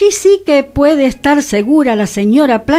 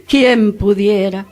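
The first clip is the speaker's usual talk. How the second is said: wistful sigh; 4 semitones lower, slower than usual. Acted. Sighed wish after laugh.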